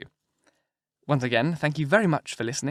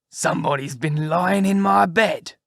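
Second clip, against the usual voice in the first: slow, loud, rough big-bear voice; great rough gruff bear; 4 semitones higher, 5 dB louder, slower than usual. Acted. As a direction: growl it out slowly as a huge bear. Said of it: "in his great rough, gruff voice".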